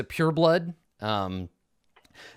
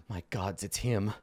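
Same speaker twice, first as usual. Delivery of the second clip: gasp, then hushed and lower; frightened whisper; 3 semitones lower, 7 dB quieter than usual. Acted. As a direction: whisper it in dread after a gasp. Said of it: "you hear Orvex whisper".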